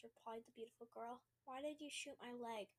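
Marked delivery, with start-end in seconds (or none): none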